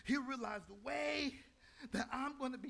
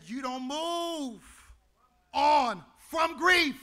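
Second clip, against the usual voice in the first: slow, each word weighed, grief stressed; slow emphatic quote; 4 semitones higher, 12 dB louder, much slower than usual. Real, not acted. Pastor quoting Nori McHenry, slow and emphatic. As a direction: lean hard on "grief". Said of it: "She said".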